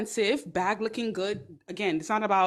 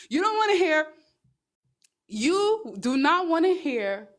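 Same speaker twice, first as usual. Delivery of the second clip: higher and louder, pushing the point; emphatic outburst; 8 semitones higher, 5 dB louder, slower than usual. Real, not acted. Ranting at viewers about job titles, emphatic outburst. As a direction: throw the line out hard.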